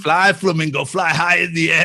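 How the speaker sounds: put-on Scarface-style Cuban accent; lightly done Cuban accent line. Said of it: "learning to do the Cuban accent".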